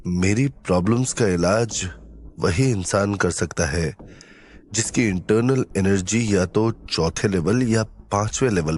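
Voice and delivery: low, measured hero's voice; pensive hero. Acted. Hero explaining to sidekick who could cure him, pensive. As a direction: speak low, pausing between thoughts.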